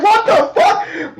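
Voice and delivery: high, slow, loud shouted disbelief; a fan's stunned shout; shocked outburst. Retold or imitated. Own reaction while reading.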